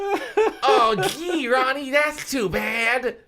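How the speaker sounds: put-on very high, loud, slow girlish falsetto; hammed-up Enid. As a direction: overplay the disappointed teen girl. Enid voiced, reacting to Ronnie.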